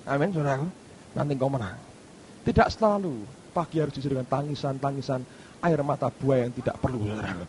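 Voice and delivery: ordinary delivery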